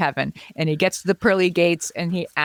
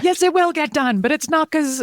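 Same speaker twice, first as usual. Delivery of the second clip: much higher, exasperated because; exasperated outburst; 10 semitones higher than usual. Retold or imitated. Wife explaining to spouse why she is mad, high exasperation. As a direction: burst out, fed up. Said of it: "I'm like".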